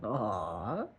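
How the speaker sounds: ordinary talk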